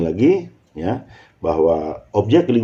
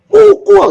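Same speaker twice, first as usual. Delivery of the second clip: "Pukul" shouted very high, then falling; shouted command intonation; 11 dB louder than usual. Shown as a demonstration. Teacher demonstrating another meaning, "Pukul" shouted high. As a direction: yell "Pukul" at top pitch. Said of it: "tapi intonasi yang berbeda".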